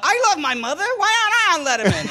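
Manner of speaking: high, fast aggrieved whine; pleading like a jealous comic; whiny complaint. Retold or imitated. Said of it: "he said something about".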